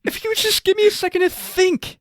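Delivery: very high, strained whimpering plea; imitating Mark Wahlberg's very high whine; mock tearful whine. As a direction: beg for a second near tears. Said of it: "he just says it over and over again".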